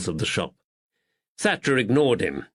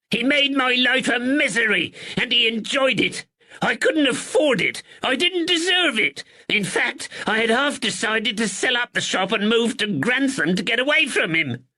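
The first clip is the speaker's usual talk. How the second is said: angry draper. Acted. Draper rails at the blackmailer to the constable, high and angry. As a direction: spit out his grievance. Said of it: "Thatcher's voice rose with anger".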